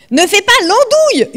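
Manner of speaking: high, loud shout to stop her; yelling at her. Acted. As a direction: yell at her to quit being silly. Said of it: "hurle Jamal".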